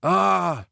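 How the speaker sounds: high-pitched yelled "ah"; frustrated cry of "ah". A narrator's reading. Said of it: "Tanner yelled".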